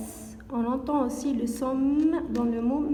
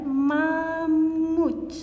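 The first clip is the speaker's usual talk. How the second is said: slowly drawn-out word; 2 semitones higher than usual. Shown as a demonstration. Teacher to children, drawing out mammouth slowly. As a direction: stretch mammouth out slowly, sounding each m. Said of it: "Je répète".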